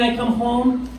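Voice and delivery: ordinary talk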